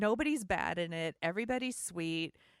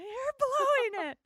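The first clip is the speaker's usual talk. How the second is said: retold yell; 7 dB louder, faster than usual.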